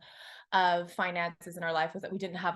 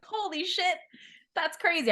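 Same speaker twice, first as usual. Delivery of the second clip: much higher, stunned delight; amazed; 9 semitones higher than usual. Retold or imitated. Retelling her first six figures with amazement. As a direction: gasp at the money like it's unreal. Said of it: "and I was like".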